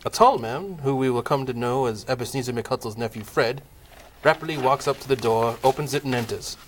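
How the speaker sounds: ordinary talk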